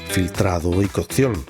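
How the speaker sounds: ordinary delivery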